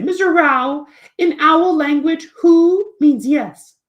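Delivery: high, loud, slow owl voice; hooty, drawn-out exasperated explanation; Snowy. Acted. Owl corrects the teacher, high, loud and slow. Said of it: "eventually Snowy will say".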